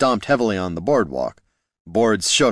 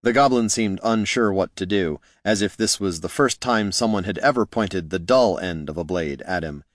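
ordinary delivery, nothing marked

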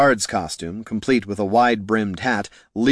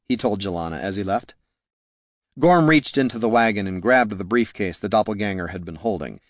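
ordinary delivery

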